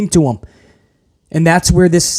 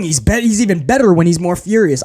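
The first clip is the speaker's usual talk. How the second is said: ordinary talk, nothing marked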